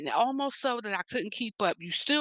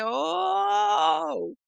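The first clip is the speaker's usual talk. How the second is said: long, high-swooping "oh"; drawn-out mock amazement; astonished reaction; 9 semitones higher, 4 dB louder than usual. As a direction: act out being taken aback, stretching it out. Retells own astonished reaction.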